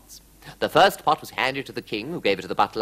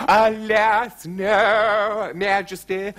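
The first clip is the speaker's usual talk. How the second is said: high, loud theatrical lament; high, impassioned courtier's regret; throbbing court magician; 9 semitones higher, 5 dB louder, much slower than usual. A narrator's reading. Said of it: "throbbed the court magician".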